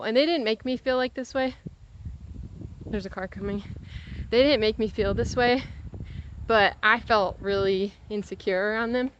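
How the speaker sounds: ordinary talk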